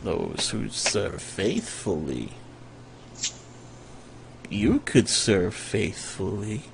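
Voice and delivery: very soft, low and slow; tempting wizard. Acted. Dying wizard tempts his questioner to serve, softly and slowly. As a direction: draw each word out quietly, luring the listener.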